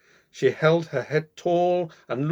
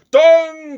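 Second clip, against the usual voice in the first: long high loud bong; clock chime; 16 dB louder than usual. A narrator's reading. Clock striking midnight, a long loud bong. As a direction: ring it out like a big clock bell.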